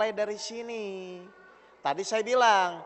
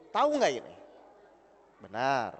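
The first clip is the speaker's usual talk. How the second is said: ordinary talk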